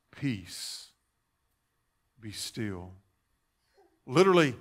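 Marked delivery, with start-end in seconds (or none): 0.1-2.9 low, soft, spaced-out command; quiet the storm without raising your voice; hushed command. Acted. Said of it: "only had to whisper".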